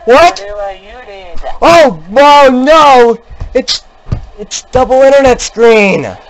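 real alarm, voice jumping up; panicked cries. Real, not acted. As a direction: cry out in fright at the monster. Frightened by monster.

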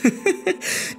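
sehr hohes, tonloses Hexenkichern; böses Kichern. Acted. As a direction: kichere schadenfroh und gehaucht. Rachsüchtige Erzählerin triumphiert. (gehauchtes böses Kichern)

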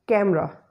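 "camera" with the middle vowel dropped. Shown as a demonstration. Teacher modelling the first example, "camera" in two syllables. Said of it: "we will pronounce it as".

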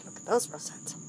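whispered admiration; soft whisper to a cat. Real, not acted. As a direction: hush it so the cat stays calm.

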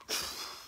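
sharp intake before a big push; quick voiceless gasp; imitated gasp. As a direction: suck in air, acting out bracing.

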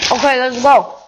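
talking through a mouthful of food; words muffled round a bite; mouth full. Real, not acted. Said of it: "I can't talk with my mouth full".

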